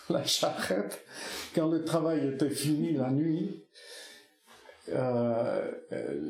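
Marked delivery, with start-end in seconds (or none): 0.0-1.0 breathy laugh through the words; fond laugh carrying the last words. Real, not acted. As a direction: laugh it out as you remember. Laughing while recalling childhood dance.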